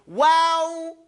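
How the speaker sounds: "Wow" long and fully mouthed; whole-mouth articulation. Shown as a demonstration. Teacher drilling students on the letter, fully mouthed drawn-out "Wow". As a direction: move the whole mouth through a held "Wow". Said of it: "Your whole mouth, move it".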